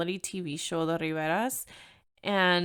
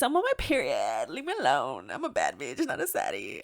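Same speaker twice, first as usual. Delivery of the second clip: sassy; 6 semitones lower than usual. Pretend. Sassy self-talk about her period.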